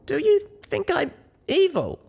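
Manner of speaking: scared to hear the answer; high, anxious, pleading; fearful question. A narrator's reading. Young pony fearing she is evil.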